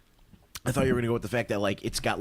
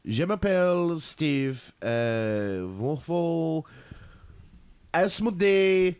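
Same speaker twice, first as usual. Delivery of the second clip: slow, hammed-up French accent; 2 semitones higher, much slower than usual. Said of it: "tell this news again in a French accent".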